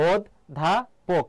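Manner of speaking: ওধ ধা পোক, split into slow separate syllables; ওধ ধা পোক, ধীরে ধীরে ভেঙে ভেঙে উচ্চারণ. Shown as a demonstration. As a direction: spell out ওধ ধা পোক slowly, a beat between each. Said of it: "অধ্যাপকের উচ্চারণটা কি হবে".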